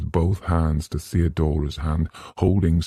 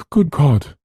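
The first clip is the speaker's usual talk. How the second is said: higher, louder aghast cry; horrified; 10 semitones higher, 6 dB louder than usual. Acted. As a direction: realize something awful. Eleanor realizes horror.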